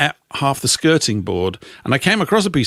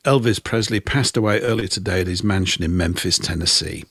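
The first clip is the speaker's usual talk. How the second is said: low, understated gloomy DJ delivery; lugubrious; 7 semitones lower, 3 dB quieter than usual. Retold or imitated. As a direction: lightly imitate a mournful newsreader, without the accent. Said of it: "in his lugubrious tones".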